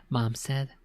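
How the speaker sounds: usual speaking voice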